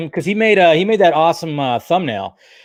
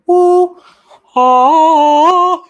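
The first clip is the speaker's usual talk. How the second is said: high, loud celebratory yell; excited whoop; 13 semitones higher, 9 dB louder than usual. Real, not acted. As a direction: burst out cheering at the reveal. Streamer celebrating the new wall.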